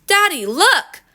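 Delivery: high, eager call to Dad; excited child calling. A narrator's reading. Daughter showing her father the cart, excited. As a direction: sing out high to get Dad's attention.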